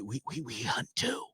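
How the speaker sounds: hushed, like sharing a secret; retold whisper. Restaurant owners secretly admit to hunting, in a hushed whisper. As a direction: imitate a furtive, whispered confession. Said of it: "why are we whispering".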